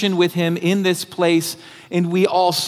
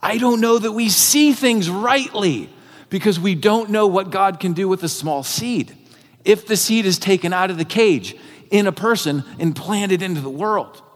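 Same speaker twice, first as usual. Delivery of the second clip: ordinary delivery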